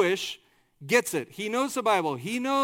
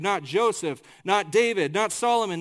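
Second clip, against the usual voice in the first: ordinary talk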